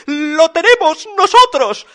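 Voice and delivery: high, loud little chorus; piglet voice. A narrator's reading. Three piglets owning up about the suit, high piping voice. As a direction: pipe up high and pleased.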